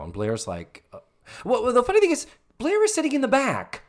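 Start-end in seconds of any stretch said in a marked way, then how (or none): 1.4-3.8 excited. Real, not acted. Excited gossip.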